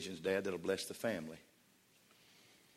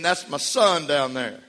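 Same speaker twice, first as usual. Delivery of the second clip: loud and high, building to a shout; God bragging on his son at full volume; proud voice of God; 9 semitones higher, 12 dB louder, slower than usual. Acted. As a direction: shout it out like a proud father in heaven. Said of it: "The voice was saying".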